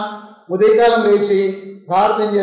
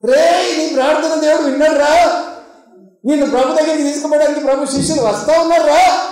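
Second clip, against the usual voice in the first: high, urgent shout of good news; excited calling out; 5 semitones higher than usual. Retold or imitated. Crowd tells blind man his prayer was heard, excitedly. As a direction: imitate the crowd hailing him eagerly.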